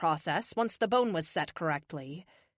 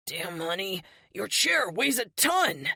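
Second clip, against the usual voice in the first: straining. Acted. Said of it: "He grunts as he lifts".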